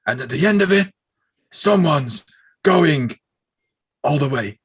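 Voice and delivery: mock promo shout, word by word; Ahmed Johnson promo. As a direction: boom it out in halting chunks. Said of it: "and then he's like".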